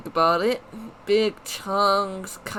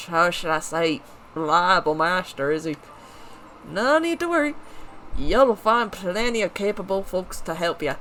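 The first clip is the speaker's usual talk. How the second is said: lightly put-on rough regional accent; a gruff woodcutter, tried-on accent; hint of a folksy woodcutter accent; [name]'s accent.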